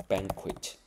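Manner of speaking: banquet ending in an audible T; pronounced final T. Shown as a demonstration. Teacher contrasts it with bouquet, final T pronounced. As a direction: stress the first syllable of banquet, sound the T. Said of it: "这个时候T就发音哦".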